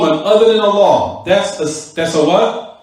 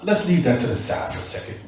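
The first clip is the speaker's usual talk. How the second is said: usual speaking voice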